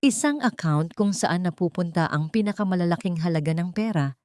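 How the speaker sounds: usual speaking voice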